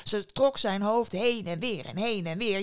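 ordinary delivery, nothing marked

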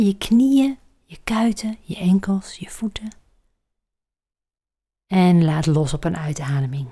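breathy guided meditation voice; soft spa whisper. Acted. Leads a relaxation exercise.

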